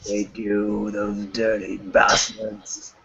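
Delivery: mock villain. Mock villain grumble after joke.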